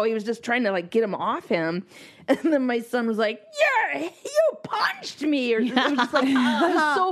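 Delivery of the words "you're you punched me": put-on high, slow hurt little boy; whiny child voice. Mother imitating her son complaining to dad. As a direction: whine it like a wronged kid.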